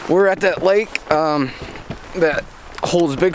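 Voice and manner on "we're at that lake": normal talking voice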